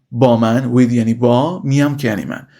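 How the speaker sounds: usual speaking voice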